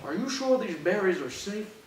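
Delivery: normal talking voice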